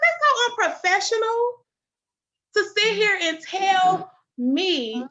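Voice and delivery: high, loud re-enacted outrage; indignant scolding. Retelling how she confronted the nurse, indignant. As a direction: stretch the last words in indignation.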